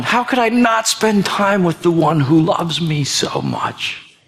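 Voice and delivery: slow, hushed sincere preaching; tender reflection. Acted. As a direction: let it sink in gently.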